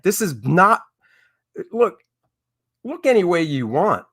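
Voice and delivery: ordinary delivery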